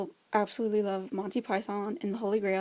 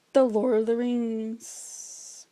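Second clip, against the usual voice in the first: grand reveal; 5 dB louder, much slower than usual. Pretend. Big reveal of favorite film.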